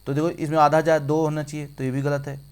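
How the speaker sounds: normal talking voice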